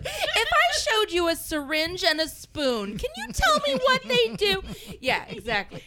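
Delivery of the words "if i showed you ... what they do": mock nurse. Mock nurse question, laughing.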